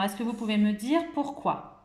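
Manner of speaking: ordinary talk, nothing marked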